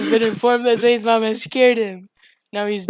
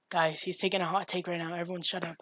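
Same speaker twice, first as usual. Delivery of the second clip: mock hushed; 4 semitones lower, 15 dB quieter than usual. Hushing others for hot take.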